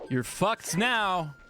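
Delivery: high, drawn-out triumphant call; punchline call-out. Acted. Guy calling to the woman he threw into the ocean, high drawn-out call. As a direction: sing out the punchline slowly, pitch soaring. Shouted punchline after throwing her.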